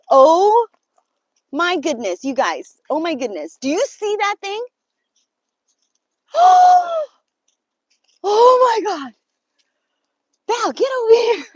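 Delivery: loud, high gasps and cries of fright; panicked, calling for help; real frightened shriek. Real, not acted. Sees creature again, calls Val.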